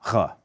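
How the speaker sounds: "R" rasped at the back of the throat; throaty uvular "R". Shown as a demonstration. Teacher naming the back R she will not use, throaty. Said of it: "the back of the throat R".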